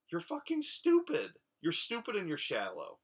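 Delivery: sudden high exasperated yell; real frustrated cry; exasperated outburst. Real, not acted. Ranting about Julia's choice.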